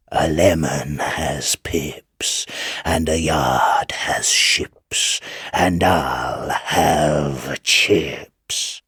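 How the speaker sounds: slow, whispered, eerie devil voice; devil's rhyme. Acted. Devil tempting young Chips in the dock, slow eerie whisper. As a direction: hiss the rhyme out slowly like a sly devil.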